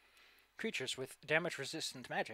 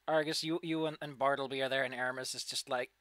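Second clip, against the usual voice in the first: ordinary talk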